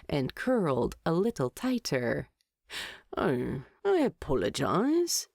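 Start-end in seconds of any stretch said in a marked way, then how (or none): 3.2-5.3 innocently. A narrator's reading. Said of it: "Thorin said innocently".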